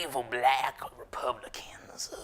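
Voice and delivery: drawn-out spooky whisper; mock their dread with a ghostly "ooh". Mocking clients' fear of Republicans.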